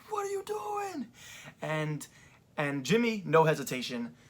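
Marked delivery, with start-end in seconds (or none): 0.1-1.1 losing it. Retold or imitated. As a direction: mimic him cracking up in shock. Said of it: "Jey was losing it".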